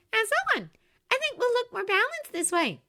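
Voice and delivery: put-on high, bright girl's voice; bubbly young woman; cheerful young woman voice. A narrator's reading. Charlie voiced.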